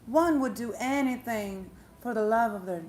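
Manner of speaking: mock-earnest, drawn-out appeal; pleading mother in court. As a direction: draw it out like a mother addressing the court. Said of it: "you know what the mother says".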